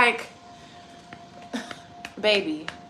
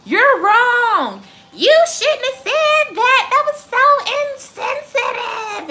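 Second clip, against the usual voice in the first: high, slow, sneering mimicry of a scold; mocking whiny accuser; 10 semitones higher, 5 dB louder, slower than usual. Mocking people who point fingers, high sneering whine. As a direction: parody the offended crowd, pitched up and drawn out.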